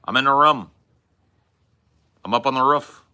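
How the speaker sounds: high, loud "room" as "rum", "roof" as "ruff"; short vowel in "room" and "roof", called out high. Retold or imitated. Imitating a friend's odd vowels, "room" like "rum", "roof" like "ruff". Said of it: "T J saying, "Yeah, rough and rum".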